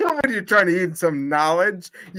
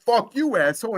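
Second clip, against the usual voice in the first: higher, mock-tough retelling of the insult; mock brawling wrestler; 7 semitones higher than usual. Retelling what Red Shorts said: mock tough, higher. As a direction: toss it off like the fighter in the ring. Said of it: "He's like".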